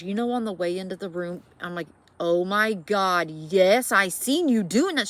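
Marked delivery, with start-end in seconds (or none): 2.2-5.1 loud, slow, freaked-out exclamation; louder and rising in alarm. Retold or imitated. Telling the cousin she saw it, loud and freaked out.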